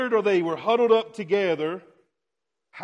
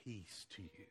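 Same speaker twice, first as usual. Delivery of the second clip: very soft, hushed "Peace to you"; hushed gentle blessing; 8 semitones lower, 25 dB quieter than usual. Retold or imitated. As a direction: breathe it out gently to frightened friends. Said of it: "and he says".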